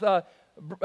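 ordinary delivery

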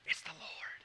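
breathy, hushed whisper. Retold or imitated. John leaning over to tell Peter, whispered. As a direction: lean in and whisper it. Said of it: "He leans over to Peter and says".

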